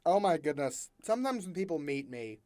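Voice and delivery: ordinary talk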